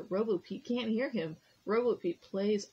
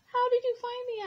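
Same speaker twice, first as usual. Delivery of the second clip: surprised; 5 dB louder than usual. Acted. Pete surprised at being found.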